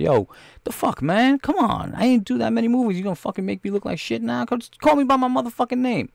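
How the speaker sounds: a fed-up actor complaining he's misnamed; imitating Leon. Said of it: "He's like".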